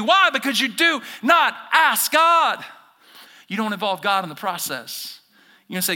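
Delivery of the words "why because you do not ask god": loud, higher, slow declaration; preaching emphasis. Acted. Pastor preaching to the church, loud and slow. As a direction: drive the point home.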